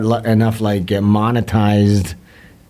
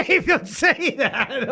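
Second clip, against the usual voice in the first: higher, slow scolding through laughter; real shocked laughter; laughing protest; 12 semitones higher, 5 dB quieter, slower than usual. Real, not acted. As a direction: laugh while telling her off. Reacting to girlfriend's outburst.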